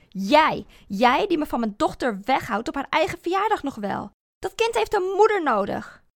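loud, building angry tirade; furious rant. Acted. Woedende tirade tegen ex-man. (steeds luidere woedende uitval)

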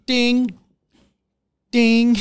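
high, insistent doorbell dings; loud pretend chime; doorbell ding imitation. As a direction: mimic someone ringing the doorbell over and over.

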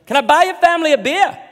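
high, loud, hammed-up Irish brogue; tipsy Irish lady giving marriage advice; drunk Irish lady accent. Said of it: "She goes".

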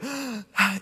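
quick startled intake of breath; sharp gasp. Shown as a demonstration. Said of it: "inhale a little bit".

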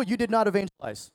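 ordinary talk

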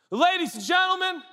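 booming high announcer voice; officiant announcement. Retold or imitated. Pastor announcing newlyweds to guests, grand and high. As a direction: present the couple grandly to the crowd. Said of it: "I'm doing that part".

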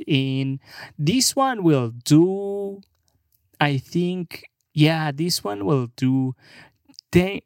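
mock Mexican accent, slow and drawn out; Mexican worker. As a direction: play a humble truck buyer with a Mexican accent. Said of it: "those fucking guys are like".

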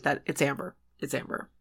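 hushed whisper of the name; whispered realization. Retold or imitated. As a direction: whisper it like a secret.

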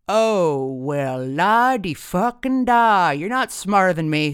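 drawn-out, swooping, belligerent sneer; loud sarcastic mockery. A narrator's reading. Tipsy dad puts down the boyfriend, loudly sarcastic. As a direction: bellow it slowly, dripping sarcasm.

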